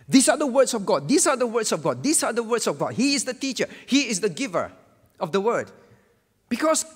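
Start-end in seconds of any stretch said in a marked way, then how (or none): 0.1-5.7 "these" hit higher and louder on each repeat; emphatic proclamation. Retold or imitated. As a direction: hammer the phrase home like a preacher. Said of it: "emphasize frequently".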